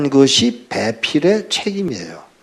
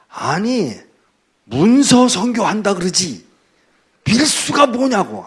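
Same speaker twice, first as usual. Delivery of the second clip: slow, much higher, indignant rebuke; angry scolding; 8 semitones higher, 3 dB louder, slower than usual. Retold or imitated. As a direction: deacon scolding the pastor over his word choice. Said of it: "화를 내더라고요". (느리고 높게 꾸짖는 목소리)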